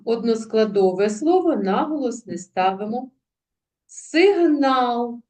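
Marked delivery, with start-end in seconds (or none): 3.9-5.2 "Сигнал" raised, with a long stressed "нал"; higher "Сигнал", stretching the stressed "нал"; stress on last syllable. Shown as a demonstration. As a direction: lean on the second syllable of "Сигнал". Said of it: "Наголошений останній склад".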